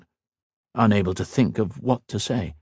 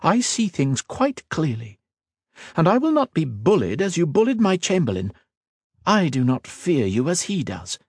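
a hint of a proud queen, raised a little; regal queen, lightly voiced; 8 semitones higher than usual. Queen questioning the mage, lightly voiced regal. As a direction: speak as a wary, commanding queen.

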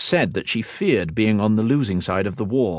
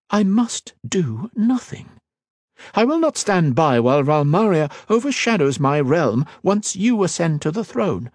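a hint of a proud queen, raised a little; regal queen, lightly voiced; 9 semitones higher, 3 dB louder than usual. Queen questioning the mage, lightly voiced regal. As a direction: speak as a wary, commanding queen.

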